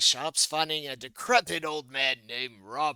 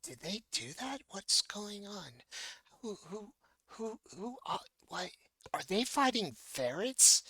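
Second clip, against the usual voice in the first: soft, high murmurs from the stands; a confused audience whispering to itself; crowd whispers; 6 semitones higher, 8 dB quieter than usual. Acted. Audience members murmuring, confused, in soft high voices. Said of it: "whispers fill the audience".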